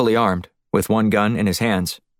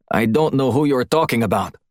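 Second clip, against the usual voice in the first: lightly voiced guard, a little higher and strained; Russian guard; 4 semitones higher than usual. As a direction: play the pinned guard denying it, barely changed from the narrator. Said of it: "the Russian said".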